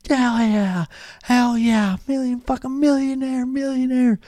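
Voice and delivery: high, whooping bro cheer; friends cheering. Retold or imitated. Friends crowded around the TV, whooping high cheer. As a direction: hype your buddy up.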